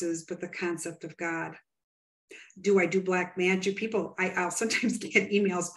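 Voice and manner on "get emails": ordinary talk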